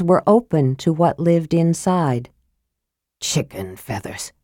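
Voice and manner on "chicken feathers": very low, hushed "Chicken feathers"; scathing mutter. A narrator's reading. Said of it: "he muttered scathingly".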